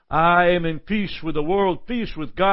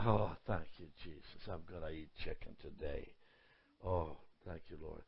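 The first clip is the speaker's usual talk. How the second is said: hushed, breathless prayer of thanks; whispered thanks; 23 dB quieter than usual. Retold or imitated. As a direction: whisper it like a private grace over food. Mock whisper prayer over chicken.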